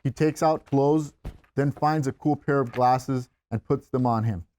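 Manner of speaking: ordinary talk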